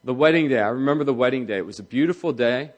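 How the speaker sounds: soft, quiet, tender recollection; fondly remember the first dance, voice softening; wife lovingly recalling her wedding day, gently; fond memory. Retold or imitated.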